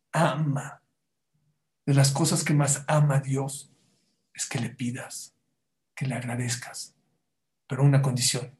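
ordinary talk

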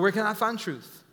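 normal talking voice

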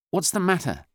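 high, loud, sharp question. A narrator's reading. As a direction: demand an answer sharply, pitch right up. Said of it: "asked Doctor Steiner sharply".

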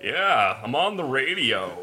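slow, full-bodied radio announcer; slow, resonant mock radio host; booming radio voice. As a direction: boom it like a DJ on air. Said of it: "beautiful booming radio voice".

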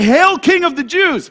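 loud sarcastic hail; mocking shout. Soldiers mocking Jesus as king, loud sarcastic hail. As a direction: jeer it out like a soldier. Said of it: "and they say".

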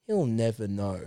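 very low, soft mock-solemn murmur; Duck, hushed. Veteran musing after the young player leaves, low mock-solemn hush. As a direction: sink low and quiet, with a pause. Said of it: "And Duck says".

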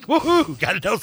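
high, giddy woohoo of triumph; mock cheer. Retelling buying pennants before the site crashed, giddy cheer. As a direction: celebrate snagging the pennants in time.

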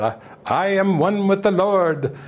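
a light hammed-up holy declaration; imitating Yogananda. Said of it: "They don't talk like Yogananda".

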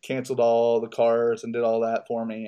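usual speaking voice

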